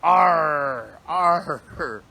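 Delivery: loud hammed-up pirate arr; playful pirate noise; pirate growl. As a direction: bellow it like a stage pirate. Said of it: "I'm meant to be talking like a pirate".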